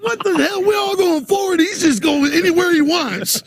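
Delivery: high-pitched, baffled comic protest; mock indignation. Host protesting the guest's backwards aging, mock indignation. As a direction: complain in playful disbelief.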